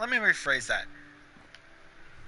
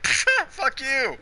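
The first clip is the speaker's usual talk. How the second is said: defiant retort; 10 semitones higher, much slower than usual. Retold or imitated. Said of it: "I'm like".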